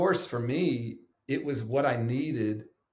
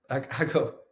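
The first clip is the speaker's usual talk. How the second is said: ordinary talk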